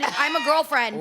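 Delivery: slow, drawn-out insistent claim; emphatic declaration. Real, not acted. Comedian insists she is no fuck buddy, emphatic. Emphatic repeat capping the rant.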